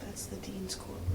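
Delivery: hushed whisper in a courtroom. Real, not acted. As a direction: keep it breathy, no voice. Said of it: "I feel like I have to whisper".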